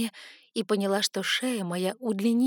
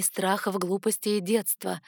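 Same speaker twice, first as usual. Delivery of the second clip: ordinary delivery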